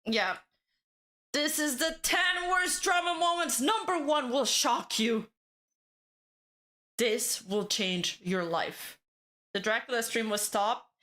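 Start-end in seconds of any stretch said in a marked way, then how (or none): 1.3-9.0 clickbait video narrator. Pretend. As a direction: sell it like a clickbait title. Mock clickbait video intro.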